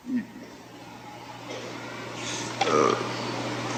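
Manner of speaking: ordinary talk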